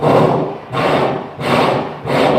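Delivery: heavy breath blown out; big voiceless puff of nerves; acted anxious exhale. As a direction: blow out a long, nervous breath. Acting out his nerves on mound.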